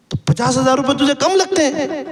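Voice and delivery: storyteller's amazed elder asking back; amazed question. A narrator's reading. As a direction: pitch it up in disbelief. Hazrat amazed at her complaint.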